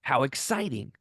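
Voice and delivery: slow, savoring delight at the challenge; drawn-out eager ooh; mock excitement. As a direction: relish it like a gift, slowly. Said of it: "I'm like".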